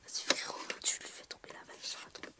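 hushed aside to a sibling; whisper. Real, not acted. Girl whispering a prompt to her brother. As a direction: whisper the cue to your brother.

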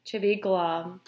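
low, sinking end of the line; glum resignation. Acted. Fish telling octopus his fate, mock-glum and low. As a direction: let it drop gloomily.

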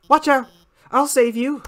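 nervous heroic scientist. Acted. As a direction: burst in to save the day. Alphys rushes to rescue.

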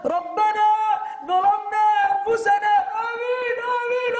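mock the imam's loud wailing plea. Shown as a demonstration. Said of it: "about to be a riot in the house of Allah".

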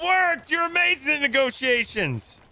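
high, elated shout; excited cheering. Real, not acted. Congratulating crewmate on the negotiations, excited cheering.

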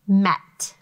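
Met said loud and crisp for sounding out; sounded-out word. Shown as a demonstration. Teacher sounding out met to listening pupils. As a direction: call out Met clearly for the class. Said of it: "Listen to the sounds".

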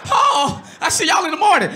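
high, loud panicked backing down; mock frightened father; scared dad. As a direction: cave in fast, terrified of Carl. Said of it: "I'm like".

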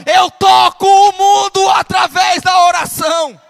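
driving sermon, growing into a very loud shout; full-force preaching. Real, not acted. Preacher declaring how far prayer reaches, building to full shout.

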